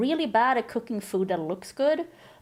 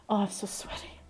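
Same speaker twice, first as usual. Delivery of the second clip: whisper; 8 dB quieter than usual. Real, not acted. Flustered aside after the alert.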